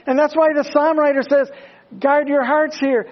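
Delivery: high, loud, rising sermon climax; full-force preaching. Acted. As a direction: preach it hard to the congregation.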